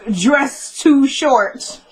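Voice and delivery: each word drawn out very slowly; slow read-out. Shown as a demonstration. Reading her own note on the dress to viewers, spoken very slowly.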